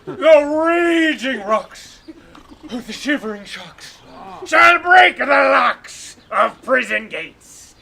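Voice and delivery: slow, booming tyrant ranting; tyrant's vein. Acted. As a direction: roar it out like a stage tyrant. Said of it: "This is the tyrant's vein".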